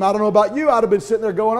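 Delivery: normal talking voice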